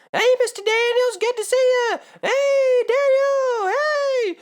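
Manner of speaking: squeaky captain voice. Retold or imitated. Voicing captain greeting passengers.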